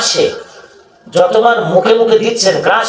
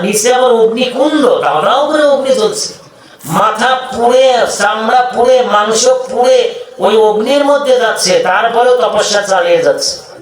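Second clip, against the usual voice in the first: raised, high-pitched preacher's delivery; fervent preaching; 5 semitones higher than usual. Acted. Preacher describing ascetics burning in the fire, fervent preaching. Preaching austerities with rising fervour.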